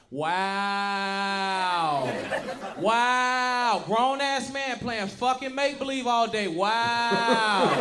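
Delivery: long drawn-out mock-disgusted wow; scornful ex imitation. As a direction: mimic loud, slow, hammed-up disapproval. Imitates disapproving ex walking past.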